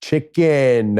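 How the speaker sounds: "chicken" stretched out very slowly; obnoxious American ordering. Retold or imitated. Rude American ordering food from an Albanian woman, obnoxiously drawn out. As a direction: drag out "chicken" like a rude tourist. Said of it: "like an asshole".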